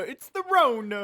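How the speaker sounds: high, slow mock Italian accent. Joking about corona layoffs, mock Italian accent. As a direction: lean into a goofy Italian accent. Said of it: "my Italian voice started coming in".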